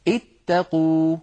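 اتقوا drawn out slowly, as ittaqu; slow demonstration. Shown as a demonstration. Teacher shows اتقوا slowly on screen. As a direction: stretch every sound of اتقوا. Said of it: "انظروا إلى هذا الفعل".

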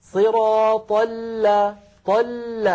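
slow, high "صراط ال" lingering on the lam; doubled lam held. Shown as a demonstration. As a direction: let "طل" ring on the first lam. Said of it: "لاحظوا هكذا". («طل» بجريان الصوت في اللام)

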